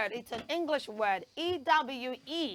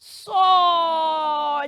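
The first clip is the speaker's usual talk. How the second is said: soar drawn out slowly, a little louder; slow clear model; 2 semitones higher, 10 dB louder than usual. Shown as a demonstration. Teacher models soar for the class, slow. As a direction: stretch the word soar out slowly. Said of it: "That word is pronounced soar".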